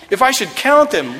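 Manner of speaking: "count" pushed high and loud, eager; hammed-up eager tally; excited counting. Shown as a demonstration. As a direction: start counting like an eager kid. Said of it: "help me count the thoughts".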